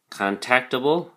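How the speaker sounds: contactable as con-TAC-table, rising at the end; stress on second syllable. Shown as a demonstration. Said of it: "emphasis is on the second syllable".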